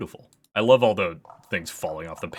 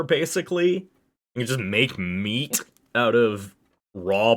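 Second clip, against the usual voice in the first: excited; 6 semitones higher, 6 dB louder, slower than usual. Real, not acted. Gushing about the character.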